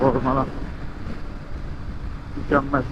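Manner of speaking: ordinary talk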